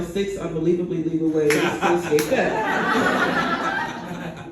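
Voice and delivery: slow, drawn-out callback through a laugh; slow laughing punchline. Shown as a demonstration. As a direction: land the twist slowly while cracking up. Callback to the article title.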